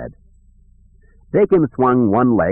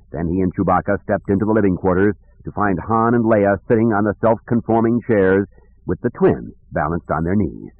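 ordinary delivery

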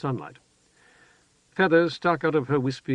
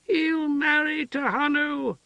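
put-on very high, slow delighted childlike voice; joyful high cry; slower than usual. A narrator's reading. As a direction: squeal it with glee. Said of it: "the woman shrieked, joyful".

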